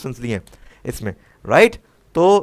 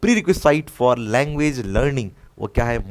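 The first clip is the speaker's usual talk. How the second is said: slow dictation; much slower than usual. Shown as a demonstration. Teacher reads exam question slowly.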